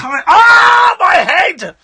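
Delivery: loud, high frustrated outburst; frustrated shout. Real, not acted. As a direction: cry out in exasperation. Sudden outburst forgetting a name.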